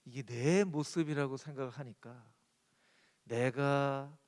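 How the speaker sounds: normal talking voice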